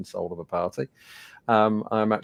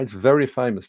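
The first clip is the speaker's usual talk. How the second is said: ordinary talk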